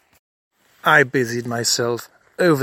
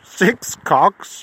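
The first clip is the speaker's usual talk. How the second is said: shocked; 6 semitones higher, 4 dB louder than usual. Real, not acted. Shocked echo of revelation.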